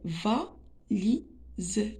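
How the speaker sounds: valise very slowly, va-li-se; teacher breaking valise into pieces; split into syllables. Shown as a demonstration. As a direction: leave a gap after each syllable of valise. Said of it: "contient trois syllabes".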